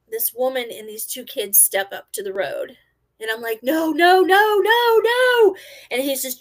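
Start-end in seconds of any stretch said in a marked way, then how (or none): 3.6-5.6 rising, loud string of panic; alarmed shouting. Retold or imitated. Driver seeing woman and kids at the road, rising panicked shout. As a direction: climb higher and louder with each word.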